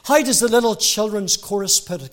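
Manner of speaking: normal talking voice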